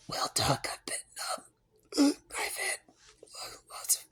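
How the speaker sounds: mock sick whimper, voice cracking; hammed-up sick patient, breathy and whining; pitiful croak. Shown as a demonstration. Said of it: "No, I need to say".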